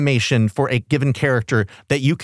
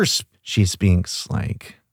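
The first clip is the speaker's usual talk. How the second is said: low creaky vocal fry; 8 semitones lower, slower than usual. Shown as a demonstration. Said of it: "in vocal fry eternally".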